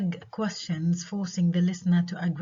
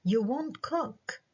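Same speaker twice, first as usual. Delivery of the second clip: high, slow "You won't cook", pitched as a question; statement as question; 6 semitones higher, 4 dB quieter, much slower than usual. Shown as a demonstration. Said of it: "خلتني أستخدمها كسؤال".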